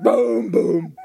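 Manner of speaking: loud booming drum sounds; imitated timpani booms. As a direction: boom like kettle drums. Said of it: "timpani drums and boom".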